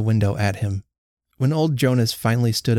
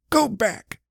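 a hint of a stubborn old husband, higher; lightly voiced curt old man; 11 semitones higher than usual. A narrator's reading. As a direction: dismiss her in two words. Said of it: "said Jonas".

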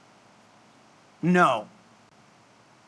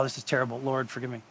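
quiet aside; 9 semitones lower, 12 dB quieter, much faster than usual. Real, not acted. Mock confession aside to God.

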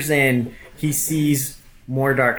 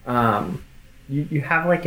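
ordinary talk

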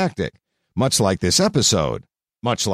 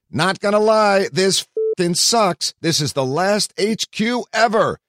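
mock yell; 10 semitones higher than usual. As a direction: shout it like a drunk game-show host at the farewell. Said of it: "yelled host Matt Richards".

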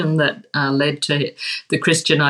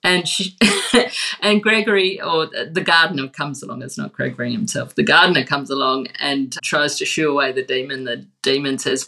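ordinary talk

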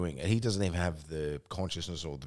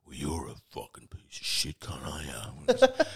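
drawn-out mock-belligerent drunk slur; imitating aggressive drunk; 2 semitones lower than usual. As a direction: act out a drunk mate picking a fight. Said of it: "yeah, aggressive".

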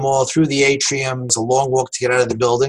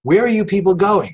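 higher, louder call from a doorway; retold yell; 8 semitones higher, 4 dB louder than usual. A stranger at the door calling down to the evacuees, loud and high. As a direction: shout down the stairwell to stop them. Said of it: "some guy yells out".

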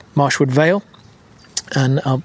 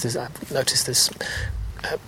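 hushed whisper, so as not to disturb; 2 semitones lower, 9 dB quieter than usual. Real, not acted. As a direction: breathe the words out softly. Quietly spotting butterflies.

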